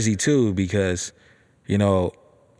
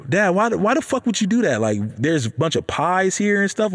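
high-pitched, baffled protest; confused disbelief; 9 semitones higher than usual. Acted. Son asking dad why he bought the pie, confused. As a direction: question it in a raised, incredulous voice. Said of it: "I'm sitting here confused".

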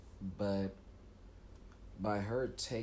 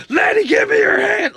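high, strained rescue shout; action-hero yell; 22 dB louder than usual. Acted. Movie hero to woman in danger, urgent yell.

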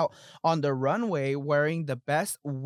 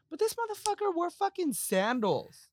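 shrieking in disbelief; high outraged squeal; 13 semitones higher than usual. Real, not acted. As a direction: squeal it out, scandalized. Outraged punchline about sandals.

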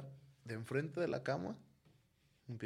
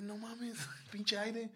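incrédulo riendo; 9 semitones higher, much slower than usual. Retold or imitated. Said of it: "así de".

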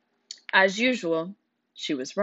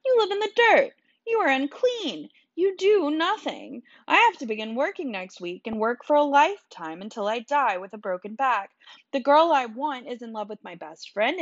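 high, heated outburst; bitter, high-pitched tirade; angry rant; 6 semitones higher than usual. A narrator's reading. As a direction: lash out at him, voice pitched high. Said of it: "I snapped".

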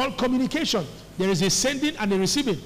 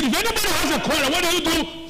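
fast, high, raised-voice challenge; forceful preaching; 8 semitones higher than usual. Real, not acted. Preacher quizzing congregation on the verse, forceful challenge. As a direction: fire the question at them, high and fast.

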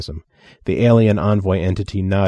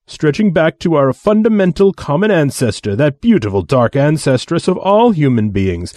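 grand, sweeping speech; dramatic delivery; 5 semitones higher, 5 dB louder than usual. Acted. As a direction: declaim it like a proud orator. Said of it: "Mobutu's dramatic delivery".

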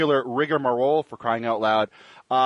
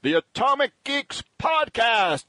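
slow, higher, grand show-name announcement; announcer voice; 9 semitones higher, slower than usual. Acted. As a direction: roll out "Atomic Geeks" like a radio announcer. Host's dramatic show-name announcement.

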